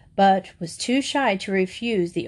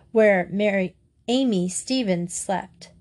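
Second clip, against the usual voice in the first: usual speaking voice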